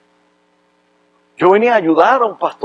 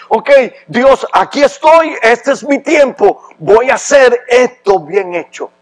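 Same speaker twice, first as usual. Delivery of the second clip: higher, louder, slowed preaching questions; fervent sermon at full force; impassioned preaching; 6 semitones higher, 4 dB louder, slower than usual. Acted. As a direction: drive each question home from the pulpit.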